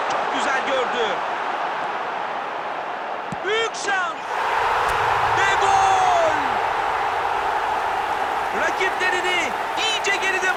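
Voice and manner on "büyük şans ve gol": loud, high goal yell; screaming football commentator. Real, not acted. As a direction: shout the goal with full force. Goal scored in live commentary. (bağıran futbol spikeri)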